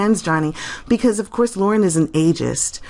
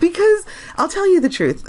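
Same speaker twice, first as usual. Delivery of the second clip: still giggling; 12 semitones higher than usual. Real, not acted. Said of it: "I can't stop laughing".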